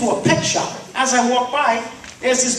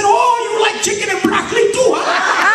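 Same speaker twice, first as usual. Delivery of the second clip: punchline shout; 10 semitones higher than usual. Acted.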